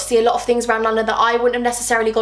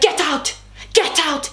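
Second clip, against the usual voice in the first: higher-pitched imitation of an angry dismissal; mock angry order; 5 semitones higher, slower than usual. Flipped boyfriend orders her out, mock angry order. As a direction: act out him throwing someone out, not shouted.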